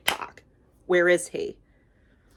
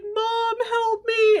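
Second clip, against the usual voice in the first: very high-pitched wail for help; mock crying scream; much slower than usual. Jack Pumpkinhead about to be eaten, mock crying scream. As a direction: squeal it as a hammed-up, desperate cry. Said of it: "he's, like, crying and screaming".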